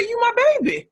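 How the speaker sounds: put-on high, loud grandmother setting a child straight; imitated grandmother. Grandmother's scolding, acted out.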